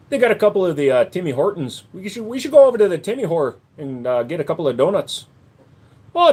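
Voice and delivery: Canadian voice drifting Irish; Canadian accent. Acted. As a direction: play the chirpy Canadian, eh. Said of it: "I started going Irish".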